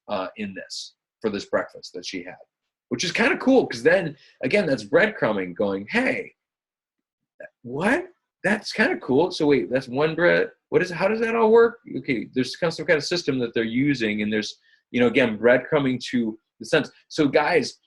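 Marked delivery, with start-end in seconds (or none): 5.9-11.8 high-pitched curious mock quote; intrigued onlooker. Coach voices a reader spotting a breadcrumb, high and intrigued. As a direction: act out someone suddenly hooked.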